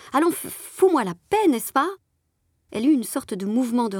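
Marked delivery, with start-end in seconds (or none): 0.1-1.9 higher and louder, sharp and hard; harsh voice. A narrator's reading. The narrator pushing off the clinging girl, harsh and loud. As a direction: shove her away with your voice. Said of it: "et d'une voix dure".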